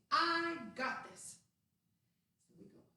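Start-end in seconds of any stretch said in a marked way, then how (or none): 0.1-1.4 loud, slow and proud; confident cheer. Shown as a demonstration. Coach teaching kids to say it, proud slow shout. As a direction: point and declare it with pride. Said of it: "we say".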